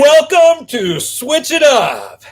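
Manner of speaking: high, loud, drawn-out show opener; announcer shout. Pretend. Host opening the live show for viewers, announcer shout. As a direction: belt out the title like a hyped host.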